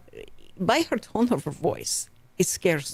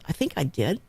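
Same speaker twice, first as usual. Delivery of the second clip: ordinary talk, nothing marked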